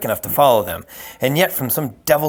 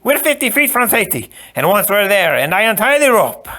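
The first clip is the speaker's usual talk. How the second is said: very high, loud sneer; gloating Sicilian; 10 semitones higher, 5 dB louder than usual. Acted. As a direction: relish his doom. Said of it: "he allowed himself".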